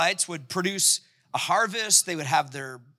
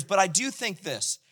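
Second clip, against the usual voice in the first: usual speaking voice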